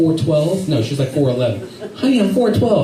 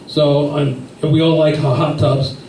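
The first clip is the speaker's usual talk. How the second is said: ordinary talk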